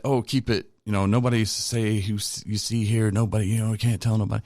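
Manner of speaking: lightly voiced preachy old-timer; mocking AA old-timer. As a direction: parody the secretive old-timer lecturing you. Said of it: "sometimes they talk like this".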